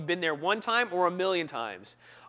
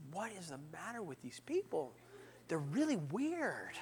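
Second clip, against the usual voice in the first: baffled aside; 13 dB quieter than usual. Retold or imitated. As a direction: mutter it in bewilderment. Hushed bewildered aside in story.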